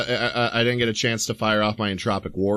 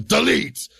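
sudden emphatic yell in character; 4 semitones higher, 3 dB louder than usual. Acted. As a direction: yell it as the spell fires. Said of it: "I yell".

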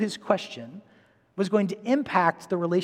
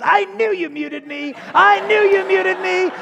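put-on kid's indignant whine; 13 semitones higher, 6 dB louder, slower than usual. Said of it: "And Nate goes".

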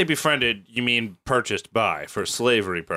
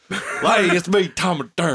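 goofy adventurer; 5 semitones higher than usual. Pretend. Voicing Thomas Dermer introducing himself.

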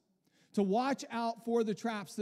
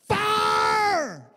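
very high, very loud shrieked "Fire"; hammed-up revivalist scream; preacher's big cry; 14 semitones higher, 13 dB louder than usual. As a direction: throw the whole voice at the man who won't fall. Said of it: "He's like".

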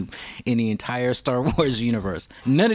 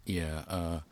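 ordinary talk

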